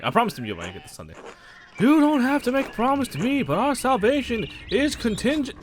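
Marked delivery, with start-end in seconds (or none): 1.8-5.6 put-on priest voice. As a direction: preach at him like a pushy parish priest. Voicing the priest again.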